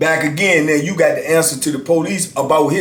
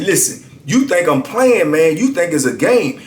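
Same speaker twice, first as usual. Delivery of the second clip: higher, hard warning; emphatic; 7 semitones higher than usual. Retold or imitated. Narrator warning the inmate at his cell, higher emphatic. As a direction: lay down the law to him. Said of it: "I was emphatic this time".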